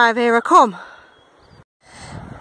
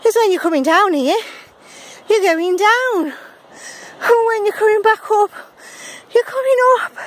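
winded; 5 semitones higher than usual. Real, not acted. Puffing up steep path, explaining.

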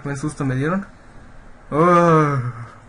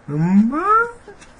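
frightened high voice; 9 semitones higher, 5 dB louder than usual. Real, not acted. Lost and scared in the game.

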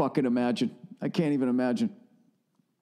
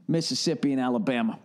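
normal talking voice

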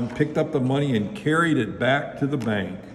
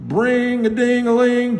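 high, loud ringing alarm bell; sing-song bell sound effect; 11 semitones higher, 6 dB louder than usual. A narrator's reading. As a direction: imitate a clanging bell, held up high. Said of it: "a loud bell".

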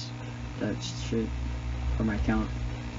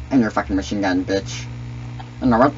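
ordinary talk

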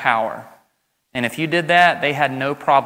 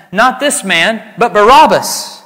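loud, higher, slow cry for Barabbas; crowd shouting; 8 semitones higher, 10 dB louder, slower than usual. Acted. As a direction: shout it as the angry mob. Crowd cries out in reading.

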